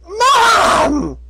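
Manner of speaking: high desperate shout. Acted. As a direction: yell for mom in panic. Filly shouts for her mom.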